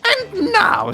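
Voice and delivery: very high regal announcement; queen announcing. Acted. Queen turning to the next contestant, announcing grandly. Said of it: "says the Queen".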